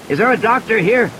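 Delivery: man shouting for a doctor in the rain; Ed calling out. A narrator's reading. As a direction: call across the beach to the doctor. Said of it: "he called to her".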